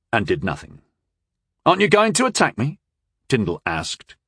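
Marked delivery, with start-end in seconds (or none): 1.6-2.8 high, quick, curious question. A narrator's reading. Apprentice to the waiting young knight, curious. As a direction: ask it with light, quick puzzlement.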